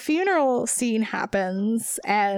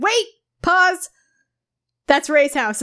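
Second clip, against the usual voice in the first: excited realization; 5 semitones higher, 6 dB louder than usual. Retold or imitated.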